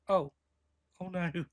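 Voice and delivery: ordinary talk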